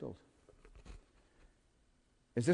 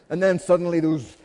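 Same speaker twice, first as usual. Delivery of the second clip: usual speaking voice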